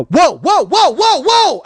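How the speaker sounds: high, very loud panicked yelling; retold scream at full volume; screamed whoa. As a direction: yell it out in rising alarm. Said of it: "that's all I start screaming is".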